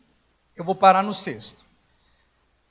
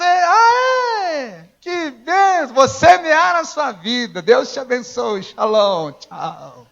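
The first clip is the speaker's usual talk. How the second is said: mock tearful thanks; 3 semitones higher, 5 dB louder, much slower than usual.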